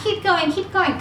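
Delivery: pitched well up, cheering herself on; peppy high encouragement; upbeat catchphrase. Retold or imitated. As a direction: chant it like a pep-talk motto. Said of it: "you guys always hear me saying that".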